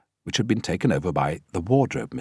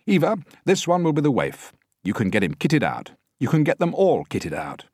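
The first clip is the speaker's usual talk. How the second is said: higher, louder director orders; 9 semitones higher, 6 dB louder than usual. A narrator's reading. Director instructs wardrobe.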